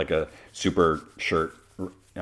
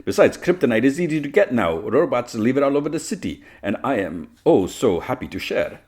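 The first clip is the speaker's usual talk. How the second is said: higher, theatrical villainess voice; gloating villainess; 7 semitones higher, 4 dB louder than usual. Acted. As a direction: relish it as a smug villainess.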